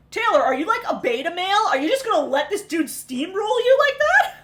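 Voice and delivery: incredulous, rising challenge; mock disbelief. Host teasing a pushover on the show, mock disbelief. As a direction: call him out in high-pitched disbelief.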